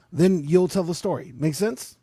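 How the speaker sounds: normal talking voice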